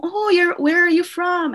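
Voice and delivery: high, eager principal asking questions; quoting the principal. Retold or imitated. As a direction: voice the keen principal, pitch up. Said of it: "Kasi yun siya tinanong".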